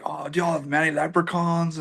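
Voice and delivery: lightly put-on Southern US accent, mocking a curious fan; Southern American accent. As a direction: play the American from the South asking about leprechauns in a Southern accent. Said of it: "you all, in the South".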